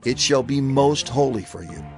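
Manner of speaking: normal talking voice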